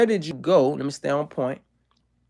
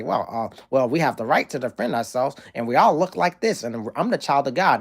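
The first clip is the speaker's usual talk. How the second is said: a hint of a smug pundit defending himself; Ben Shapiro impression; 4 semitones lower than usual. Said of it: "He's gonna say".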